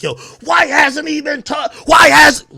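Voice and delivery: high, loud demanding yell; hammed-up high shout; mock fan shout. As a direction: mimic pushy fans yelling at him. Mocking fans demanding coverage.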